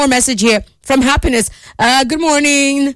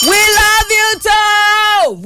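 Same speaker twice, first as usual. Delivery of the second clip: high, drawn-out loving call; 10 semitones higher, much slower than usual. Real, not acted. Host calling back to fond listeners, high and drawn out. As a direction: sing it out high and slow, full of love.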